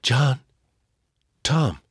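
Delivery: faint, weak sick mother; barely able to speak, weak. A narrator's reading. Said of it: "Mama said in a weak voice".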